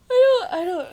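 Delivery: talking through a yawn. Real, not acted. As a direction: speak mid-yawn, stretched. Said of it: "sorry, I yawned there".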